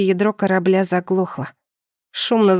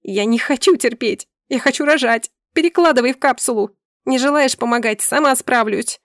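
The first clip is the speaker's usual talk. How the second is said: insistent, higher-pitched outburst; angry demand; 4 semitones higher than usual. Acted. Wife in labour orders her husband about, angry demand. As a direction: refuse to wait a moment longer.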